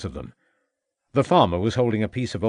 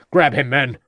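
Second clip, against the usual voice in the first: loud, higher command; shouted order; 4 semitones higher, 6 dB louder than usual. A narrator's reading. Inspector ordering his officers to seize the farmer. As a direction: bark it at the officers.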